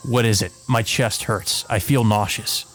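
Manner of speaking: normal talking voice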